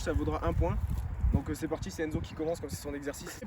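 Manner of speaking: ordinary delivery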